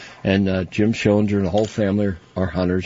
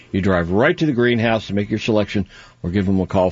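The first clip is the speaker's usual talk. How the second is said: usual speaking voice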